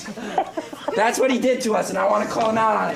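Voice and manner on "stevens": shouted warlord boast; barbarian. Retold or imitated. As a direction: roar the name, then laugh. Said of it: "For I am Gur Stevens".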